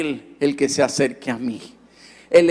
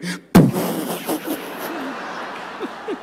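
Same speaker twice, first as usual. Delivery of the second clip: emotional laugh; 6 semitones higher than usual. Real, not acted. Emotional recollection.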